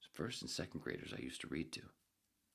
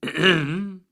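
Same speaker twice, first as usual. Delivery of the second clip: much higher and louder, hammed-up apology; mock formal apology; 10 semitones higher, 19 dB louder than usual. Reader apologizing for clearing throat, hammed-up. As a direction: blurt a theatrical apology.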